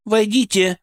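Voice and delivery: high call to come in. A narrator's reading. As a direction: call toward the door. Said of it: "крикнула она".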